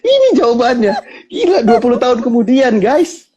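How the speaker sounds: high, laughing disbelief; laughing amazement. Real, not acted. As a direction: marvel while still laughing. Host keeps laughing in amazement. (takjub sambil tertawa)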